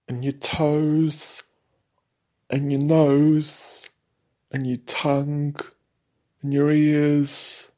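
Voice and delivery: high, loud camp London monster voice ranting; ranting cobweb monster. Acted. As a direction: rant through the door listing body parts to eat. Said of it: "He was ranting away at the door".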